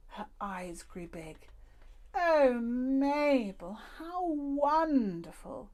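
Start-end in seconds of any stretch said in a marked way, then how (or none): 2.1-5.7 very slow, higher, awed and delighted; marvelling old lady. A narrator's reading. The old lady seeing the imagined paradise, slow and awed. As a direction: gasp it out in wonder. Madame Badobadar voiced, marvelling.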